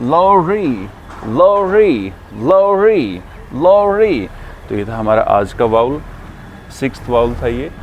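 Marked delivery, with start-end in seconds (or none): 0.1-4.3 lorry as "lori", rounded short o, higher, louder, falling. Shown as a demonstration. Teacher drilling students on the rounded o in lorry. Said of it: "next word है".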